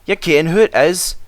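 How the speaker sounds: high Scottish engine voices; Scottish twins. A narrator's reading. Twins answer the Fat Controller in a Scottish brogue. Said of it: "spoke in chorus".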